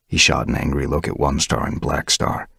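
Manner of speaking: ordinary delivery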